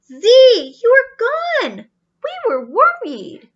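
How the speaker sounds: high, loud, relieved calling out; worried robot family. Acted. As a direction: call out to the lost little one.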